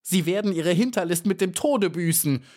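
high, defiant cry at the spider; narrated scream. A narrator's reading. Trapped bee crying defiance at the spider, high mock scream. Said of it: "schrie sie der Spinne entgegen".

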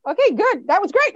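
put-on high, loud, over-bright praise to a child; mother praising her son, high and cheerful; mock-cheerful praise. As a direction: pump up the praise like a relieved parent. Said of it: "And I was like".

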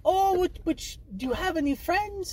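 high, gentle asking voice; sweet childlike question. Retold or imitated. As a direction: coax him like a small child. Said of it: "And she's like".

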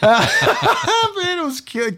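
high squeal of laughter through words; laughing. Real, not acted. Man recalling prank, squealing with laughter.

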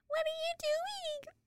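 put-on high, tiny drawn-out child voice; little girl. Podcaster imitating a cute little girl at the rink, childlike. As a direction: mimic a small child, high and soft.

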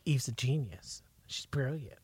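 soft, breathy whisper of praise. Retold or imitated. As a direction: whisper it like a secret compliment.

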